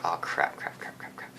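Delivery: quick repeats sinking to a whisper; muttered dread. Retold or imitated. Student sees the officer driving over, muttered dread. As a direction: mutter it under your breath, fading away.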